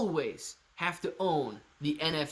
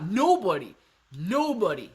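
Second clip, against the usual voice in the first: defiant; 3 semitones higher, 5 dB louder than usual. Real, not acted. Playful defiance to chat.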